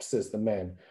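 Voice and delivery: plain reading voice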